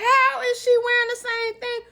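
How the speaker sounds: very high, loud mock indignation; hammed-up outraged question; retold incredulous outcry. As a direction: squeal it in disbelief, acting her out. Retold shock at seeing matching locket.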